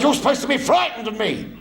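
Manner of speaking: high, fast, indignant demand; indignant petty officer. Retold or imitated. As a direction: fire it off at the cheeky recruit. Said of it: "He said".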